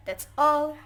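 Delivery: a high, loud, bright "that's all"; cheerful finish. Real, not acted. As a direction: announce the end with relief. Cheerfully wrapping up presentation.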